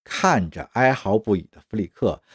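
usual speaking voice

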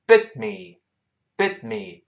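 BIT ME joined, the t of BIT held silent; linked, unreleased t. Shown as a demonstration. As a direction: hold the t, then straight into ME. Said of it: "连读变成bit me". (bit me 连读，t 不爆破)

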